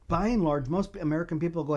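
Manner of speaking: ordinary talk, nothing marked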